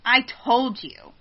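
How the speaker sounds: slow, loud, put-on huffiness; getting an attitude. Intruder insisting to her, slow with attitude. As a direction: say it slowly with acted attitude. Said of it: "he's getting an attitude now".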